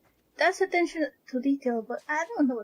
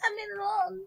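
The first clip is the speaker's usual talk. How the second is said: whiny plea; 7 semitones higher than usual. Pretend. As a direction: beg like a whiny kid. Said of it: "I wanna run".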